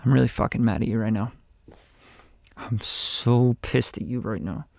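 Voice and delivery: low, quiet, contained anger; really mad. Shown as a demonstration. Coach shows how really mad people talk, quietly angry. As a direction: keep the fury down, low and soft. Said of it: "when you're really mad".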